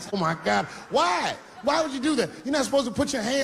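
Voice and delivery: high, incredulous why; father shocked at his son, high and incredulous. Retold or imitated.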